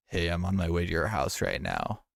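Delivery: the low voice a parent hears on the phone; deep voice. Shown as a demonstration. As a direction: drop low into the old deep register. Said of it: "a voice that just says".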